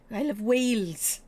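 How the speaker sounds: put-on Welsh lilt on WALES; Welsh accent. Shown as a demonstration. Streamer showing off love of Wales, Welsh accent. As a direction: declare it with a Welsh vowel in Wales. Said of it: "comes out in a Welsh accent".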